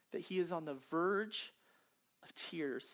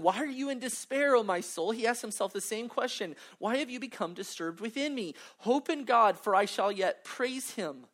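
impassioned reading; 5 semitones higher, 6 dB louder than usual. Acted. Reads psalm with passion.

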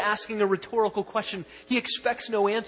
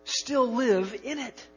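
higher, louder, slow declaiming; preaching scripture; 5 semitones higher, 4 dB louder, slower than usual. Acted. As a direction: proclaim the verse to the congregation. Reads the verse with force.

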